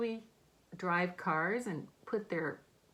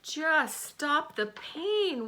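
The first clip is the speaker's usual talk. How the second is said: whimpering; 7 semitones higher, much slower than usual. A narrator's reading. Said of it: "whimpered the fox".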